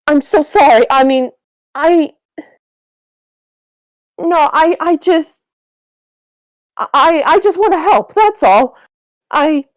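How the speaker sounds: flustered apology. Acted. Wilbur backpedals after outburst.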